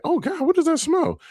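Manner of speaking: high, grossed-out squeal; disgusted. Retold or imitated. Skater recalling the smelly shirt, grossed out high. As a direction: recoil from the stink.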